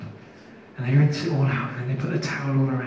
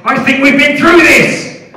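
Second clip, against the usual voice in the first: higher, louder weary sass; exasperated retort; 13 semitones higher, 11 dB louder than usual. Retold or imitated. Comedian answering the interrogator, loud exasperated sass. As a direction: roll your eyes through it. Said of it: "And I said".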